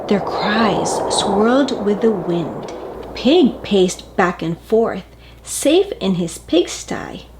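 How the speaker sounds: plain reading voice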